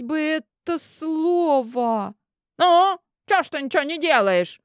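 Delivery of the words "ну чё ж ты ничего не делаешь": high, loud indignant heckle; indignant heckler. Acted. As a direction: heckle her, fed up. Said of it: "Возмутился всё тот же голос".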